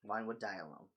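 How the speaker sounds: usual speaking voice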